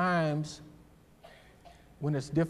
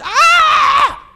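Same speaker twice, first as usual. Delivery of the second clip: sudden high shrieking yelp; startled scream; scream of fright; 18 dB louder than usual. Acted. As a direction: shriek like you just saw a snake. Moses flees from the snake.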